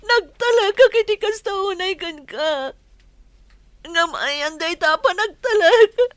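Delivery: loud, crying accusation; tearful angry shout. Acted. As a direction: cry out the betrayal, loud. Mistress raging at cheating lover. (agsangsangit nga ikkis)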